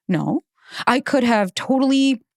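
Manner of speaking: ordinary delivery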